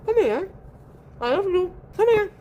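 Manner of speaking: high, sweet calling voice to a pet; high pet-talk call; cat-calling voice. Real, not acted. Calling her cat over.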